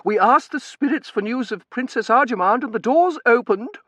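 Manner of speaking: high, loud, mounting agitation; voice rising. A narrator's reading. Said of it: "she said, her voice rising".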